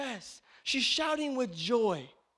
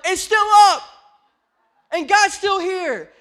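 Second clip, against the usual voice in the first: shout; 9 semitones higher, 14 dB louder than usual. Real, not acted. Preacher shouts good news.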